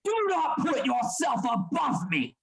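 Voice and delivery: high, furious rebuke; angry shout at a defiant assistant. Acted. Heated quarrel over the murder.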